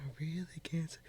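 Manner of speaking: half-whispered aside; breathy whisper of the lyric. Acted. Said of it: "I can't sing too much".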